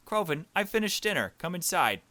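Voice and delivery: high, loud calling voice; voicing the character. Player voicing the game character calling cousin in, high and loud. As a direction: call the cousin in for dinner. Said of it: "so this is our cousin".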